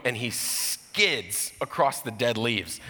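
drawn out, very slow; slowed storyteller suspense; slow dramatic telling. Acted. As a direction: stretch each word as the dog slides. Dramatic retelling of dog skidding.

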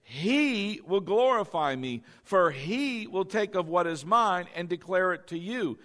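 "He" stretched out as the verse is read; stress on HE. Shown as a demonstration. Preacher showing the Holy Spirit is a person, stress on HE. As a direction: lean on each "he", then carry on. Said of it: "I emphasize the word he".

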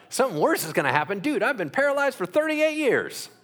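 high, fast, disbelieving protest of a man paralyzed for years; indignant healed man. Acted. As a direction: squeal it out in outrage, as if the warning were absurd. Healed man protests Jesus' warning.

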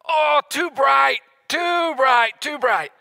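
put-on high, loud child's wail, easing off; imitated little kid. Son brought out of dark room, imitated wailing complaint. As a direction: cry out drawn-out like a dazzled toddler. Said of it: "you know what this little kid says".

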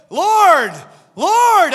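loud, high call to the Lord; mock desperate cry. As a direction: cry out to heaven in hammed-up panic. Crying out to Lord in mock panic.

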